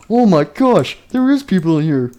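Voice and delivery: high, excited character voice; thrilled exclaiming. Acted. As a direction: exclaim it high with giddy delight. Nightmare Freddy thrilled at finding people.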